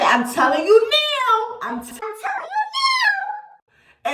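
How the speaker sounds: frightened warning. Retold or imitated. Warning a stranger, scared and shaking.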